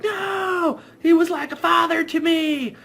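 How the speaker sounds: very high hammed-up grief; Denny mourning in a silly high cry; mock falsetto wail. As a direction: wail it in a squeaky campy voice. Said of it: "he's like".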